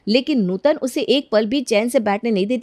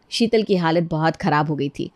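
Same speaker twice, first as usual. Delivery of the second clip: usual speaking voice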